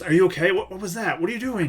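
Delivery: high, urgent worry; worried father. Acted. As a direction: rush out worried questions. Dad runs over worried.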